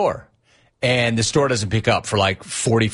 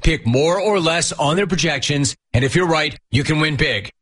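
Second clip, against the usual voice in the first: ad read; 4 semitones higher than usual. Acted. Host reads sponsor ad.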